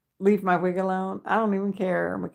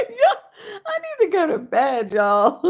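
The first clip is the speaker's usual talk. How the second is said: high laughing whine; 12 semitones higher, 7 dB louder than usual. Real, not acted. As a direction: giggle through the words.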